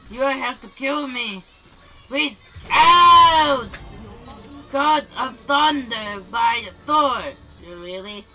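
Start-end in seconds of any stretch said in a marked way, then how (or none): 2.7-7.2 loud yell in the heat of play; a full-force gamer yell; real shout. Real, not acted. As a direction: belt it out. Shouting during the game.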